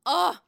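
high loud groan of delight; loud disgusted ugh. Real, not acted. Vlogger reacts to the famous move with a loud ugh. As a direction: let out a big ugh.

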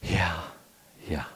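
quiet, drained agreement after a deep breath; imitating an overwhelmed parent, subdued; worn-out sigh. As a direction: let it out softly, spent. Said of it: "she just took this deep breath".

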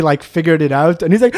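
ordinary talk, nothing marked

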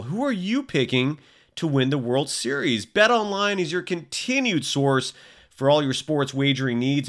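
upbeat sponsor read for BetOnline. Acted. Host reading the BetOnline ad to listeners, upbeat ad voice. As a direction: sell it like a radio spot.

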